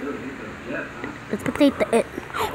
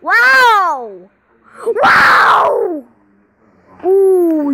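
loud high wow; excited shout; 6 semitones higher, 7 dB louder, much slower than usual. Real, not acted. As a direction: yell it out, thrilled.

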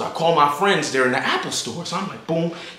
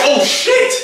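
high, hyped-up retold shriek; squealed astonishment, stretched out; energy suddenly changed; 8 semitones higher, 3 dB louder than usual. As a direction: explode upward in amazed excitement. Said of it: "my energy done changed".

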